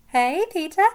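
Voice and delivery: high, loud playful hello; giggly greeting. A narrator's reading. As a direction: call out a teasing, delighted greeting. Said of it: "Madge giggles".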